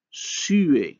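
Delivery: SUER said in French with a w-like glide after the vowel; glide pronunciation. Shown as a demonstration. Lecturer showing students how French inserts a glide, glide pronunciation. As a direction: say SUER slowly as French, gliding between the two vowels. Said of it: "and we pronounce that".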